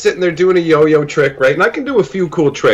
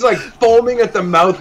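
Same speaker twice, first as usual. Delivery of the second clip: usual speaking voice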